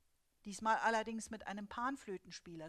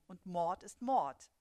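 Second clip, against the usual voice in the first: slow, loud and final; a stern, slow husband's verdict; 8 dB louder, slower than usual. A narrator's reading. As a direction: spell out the rule slowly and sternly. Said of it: "sagt der Gatte streng".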